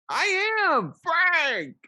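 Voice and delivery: excited. Real, not acted. Excited reply in the same burst.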